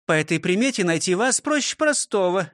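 proud, high-pitched teacher; high voice, mildly pleased with herself; proudly explaining. A narrator's reading. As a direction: explain with a touch of pride. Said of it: "с гордостью объяснила Фрёкинсталь".

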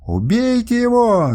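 the princess screaming for his death. A narrator's reading. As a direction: scream it with all her fury. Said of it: "завопила она".

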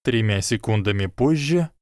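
usual speaking voice

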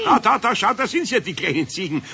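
spot the goats with delight; excited pointing. Acted. Eder showing Pumuckl the little goats, excited.

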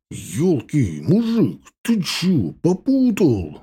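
high, drawn-out mock yell; startled, outraged challenge; indignant yell. A narrator's reading. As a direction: shout it without much volume. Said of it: "закричал ему Лёня".

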